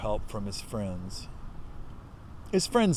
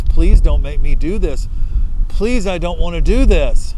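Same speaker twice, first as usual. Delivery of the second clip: much louder, begging cry to God; full-voiced desperate begging, voice straining; desperate crying plea; 4 semitones higher, 14 dB louder than usual. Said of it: "He's crying. He's asking God".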